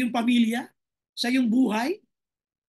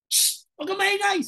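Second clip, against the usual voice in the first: higher-pitched mock shushing; shushing disciples; 6 semitones higher than usual. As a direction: hush him as an annoyed disciple would. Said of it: "sabi ng mga alagad".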